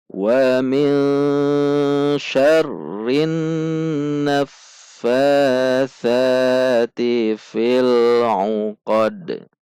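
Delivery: slow chanted tajwid recitation with long held vowels; measured Quranic chant. Shown as a demonstration. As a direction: recite it slowly, stretching the long sounds. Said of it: "Mari kita baca".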